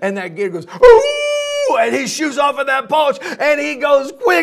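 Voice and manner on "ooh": high, loud yelp of pain. Acted. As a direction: yelp like you just got whacked. Gator hurt by broom.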